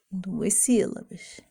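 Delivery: ordinary talk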